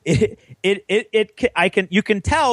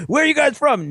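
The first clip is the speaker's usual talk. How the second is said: much higher, demanding; higher mock interrogation; questioning Texan; 11 semitones higher than usual. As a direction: ask it sharply up high. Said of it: "it's like".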